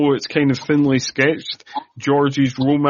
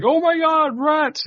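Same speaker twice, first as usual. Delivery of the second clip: mock freak-out. Co-host imitates everyone spotting the rats, mock panicked. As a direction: squeal it in hammed-up terror. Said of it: "freaks out and everybody's like".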